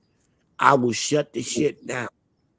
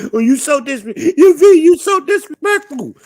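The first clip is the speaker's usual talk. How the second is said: high, loud put-on woman's voice, offended; mock whiny woman; 13 dB louder than usual. Mocking a woman who calls him disrespectful, high and whiny.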